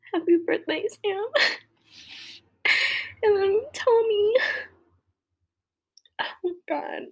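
high tearful voice, laughing through it. Real, not acted. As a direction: greet them through real tears. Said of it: "Why am I crying?".

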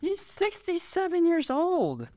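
high, drawn-out incredulous squeal; real high-pitched astonishment; shocked disbelief. Real, not acted. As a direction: stretch it out high, stunned by the number. Shocked at an actor's age.

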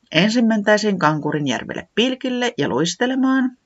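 ordinary delivery

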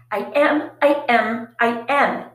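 loud, insistent shout; teen shouting I am over and over. Acted. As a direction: shout it back at her mother. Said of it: "Phoebe shouted at her mother".

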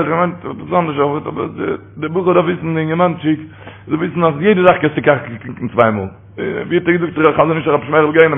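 normal talking voice

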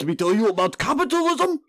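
high-pitched, badly missed politician accent; hammed-up stump speech that drifts toward Dracula; Bernie Sanders impression. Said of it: "he's trying to do a Bernie accent".

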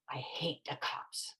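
very soft, slow and half-whispered; quiet mock mutter. Ten-year-old boy to himself near a detective, muttered softly. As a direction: say it low, imitating a kid under his breath. Boy's first, unasked remark about cops.

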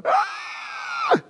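very high-pitched scream of alarm; kids' mock scream. Kids seeing dad really angry, mock frightened scream. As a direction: imitate frightened children shrieking. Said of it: "when my kids saw that".

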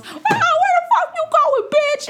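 high, loud mock yell at a woman walking off. She yells at a woman heading to the car, loud and high. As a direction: imitate her shrieking from the car. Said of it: "the woman yells".

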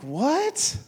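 high, rising startled question; mock disbelief. Dad hearing he said a cuss word, acted disbelief. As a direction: act out a baffled dad's reaction. Father hears he supposedly cursed.

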